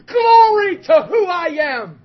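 high, drawn-out, booming shout of triumph; pumped-up shout. Retold or imitated. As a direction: shout it exultantly, stretching the words out. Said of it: "Don't think he wasn't pumped".

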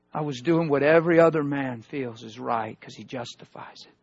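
ordinary talk

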